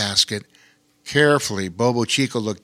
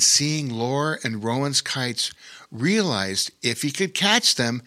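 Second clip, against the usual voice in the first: ordinary delivery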